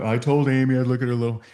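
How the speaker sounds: quick condescending imitation. Prospect dismissing the home business, quick and condescending. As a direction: mimic her talking down, hurried through. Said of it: "exactly how she said it".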